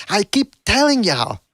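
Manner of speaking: exaggerated imitation, pitched well up; mock father-trainer. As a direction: parody the boasting dad, fed up with him. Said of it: "tired of these father-trainer combos".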